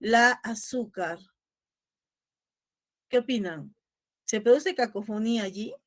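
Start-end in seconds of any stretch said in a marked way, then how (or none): 0.0-1.2 la azúcar drawn out slowly, la lengthened; slow quiz phrase. Shown as a demonstration. Teacher posing a quiz word to the class. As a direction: stretch out the la in la azúcar. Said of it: "He escrito una palabra en el chat".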